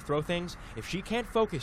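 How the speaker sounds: usual speaking voice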